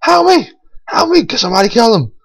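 high, hammed-up yell for help; mock cry for help. Player trapped under grenades, pleading for teammates with mock panic. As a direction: squeal help like you're pinned down. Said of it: "and I was like".

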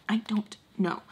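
soft whispered repeat. Real, not acted. Admitting she can't explain petting him, whispered. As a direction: drop into a whisper, as if to yourself.

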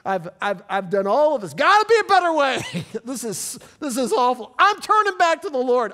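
voicing a fed-up sinner, loud and high; worked-up mock confession. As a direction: act the sinner who's had enough. Acting a fed-up believer.

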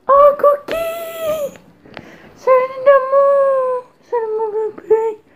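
very high, slow cooing pet voice. Real, not acted. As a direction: coo to the puppy. Greeting her dog lovingly.

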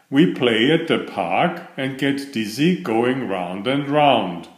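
ordinary delivery, nothing marked

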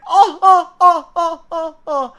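high theatrical ah-ah groans, fading away; mock groan. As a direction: groan in mock disgust at tripe soup. Groaning over tripe soup. (işkembeye karşı abartılı inleme)

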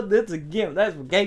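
lightly drawled southern twang; a hint of a Southern yokel; southern accent. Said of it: "I just used a southern accent".

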